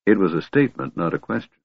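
ordinary delivery

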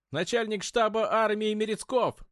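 high, loud report at attention. Acted. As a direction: bark it out standing straight. Company commander reports standing at attention.

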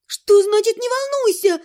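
high panicked cry of disbelief; panicked exclaim. A narrator's reading. Heroine panics at the ghost's words: high panicked exclaim. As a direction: blurt it out in panic. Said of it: "воскликнула я в панике".

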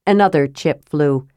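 ordinary delivery, nothing marked

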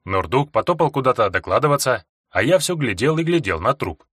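ordinary delivery, nothing marked

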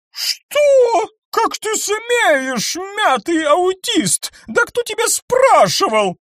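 high, loud, slow outraged screech; furious shriek. A narrator's reading. Eleonora screams at Fox for insulting her. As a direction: spit the insult at him.

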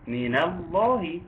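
ordinary talk, nothing marked

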